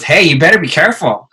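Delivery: high, loud mom warning; imitated warning mom. Mom's warning, acted out.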